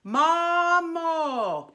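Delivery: "Mamo" held long on a pure "o"; model mamo. Shown as a demonstration. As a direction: sustain "Mamo", placed and clean. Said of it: "Try that".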